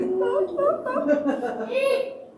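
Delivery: strained squeal of laughter; pained high laugh. Real, not acted. Squeezed hand crushed by the boxer, a high pained laugh. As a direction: laugh through the pain. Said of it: "almost crying".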